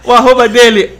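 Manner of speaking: ordinary delivery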